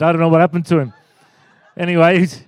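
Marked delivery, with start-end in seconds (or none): none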